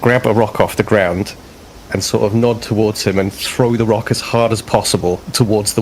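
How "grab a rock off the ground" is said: ordinary talk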